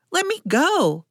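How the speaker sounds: high-pitched startled protest; surprised yell. A narrator's reading. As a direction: cry out to break free, no real volume. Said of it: "she yelled, more out of surprise than fear".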